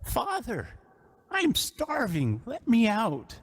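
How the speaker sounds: a trapped son begging from beneath the floor; young man crying out. Acted. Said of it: "you hear a young man's voice cry out".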